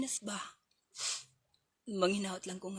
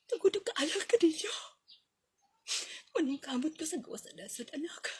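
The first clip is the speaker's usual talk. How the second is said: whispered; 4 semitones higher than usual. Acted. Whispered wish to children.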